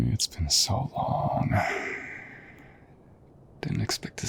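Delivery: hushed, tender whisper during a hug; soft whisper. Acted. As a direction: breathe it close into the friend's ear. Hugging an old friend.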